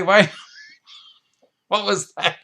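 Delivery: high, laughing mock surprise; laughing tease. Real, not acted. Host laughing at a cheeky viewer comment, high amused surprise. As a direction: chuckle it out, caught off guard.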